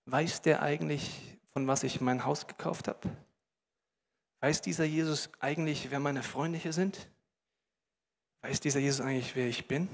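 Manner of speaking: quiet, low-pitched thought; subdued, pensive questioning; uneasy inner wondering. Acted. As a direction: ask it inwardly, hushed and deliberate. Zachäus wonders inwardly before Jesus.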